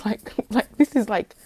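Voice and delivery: usual speaking voice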